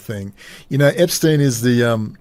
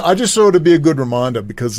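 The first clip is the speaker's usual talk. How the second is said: ordinary talk, nothing marked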